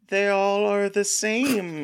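put-on high, drawn-out kid voice; movie kid. Critic quoting the kid's line in a disaster movie, high drawn-out kid voice. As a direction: stretch it out slowly in a high child's voice. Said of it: "we have the kid saying".

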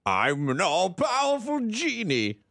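high, slow booming genie voice; hammed-up genie, drawn out; all-powerful genie. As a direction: proclaim it like a cartoon genie. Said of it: "not supposed to do voices".

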